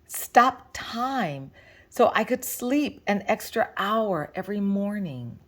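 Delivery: ordinary delivery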